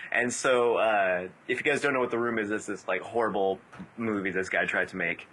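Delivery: usual speaking voice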